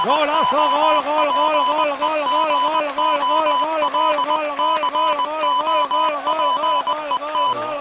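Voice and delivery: rapid-fire gol gol gol; breakneck goal commentary. Real, not acted. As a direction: shout the goal over and over. Goal scored, extended gol cry.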